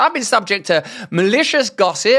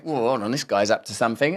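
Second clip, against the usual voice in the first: softer, quick inner voice; mock thought; 11 semitones lower, 7 dB quieter, faster than usual. Host voicing a bystander spotting Hitler, soft mock wariness. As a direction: mutter it like a wary bystander. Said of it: "You think".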